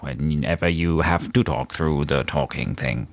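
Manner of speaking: low, slow robot voice; robot talk. Shown as a demonstration. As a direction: drone it out like a robot. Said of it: "talk like a robot".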